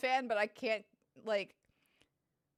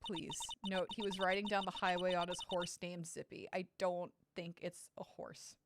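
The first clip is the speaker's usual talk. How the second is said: normal talking voice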